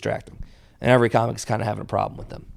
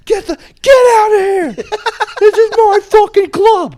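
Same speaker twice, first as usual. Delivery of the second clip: screamed far higher and louder, enraged comic; furious shout; 9 dB louder than usual. Retold or imitated. Bombing headliner screams at chatty audience ladies, furious shout. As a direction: bellow it at the hecklers like you've snapped. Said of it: "And he's like".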